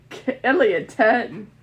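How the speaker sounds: usual speaking voice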